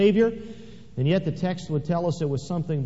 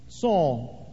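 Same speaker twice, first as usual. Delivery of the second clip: voice from heaven. Acted. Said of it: "I heard a voice saying".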